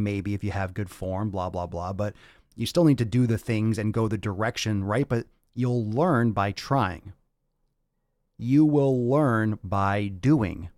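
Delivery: normal talking voice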